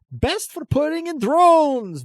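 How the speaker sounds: high, slow huckster shout; eccentric merchant voice. Acted. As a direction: hawk it like a crazed trader. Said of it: "and he says".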